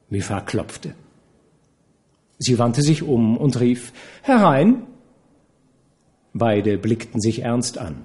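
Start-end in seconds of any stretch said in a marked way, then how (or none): 4.2-4.8 high call of come in; voiced Nana calling out. A narrator's reading. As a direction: call through the door, bright and loud. Nana calls out to door.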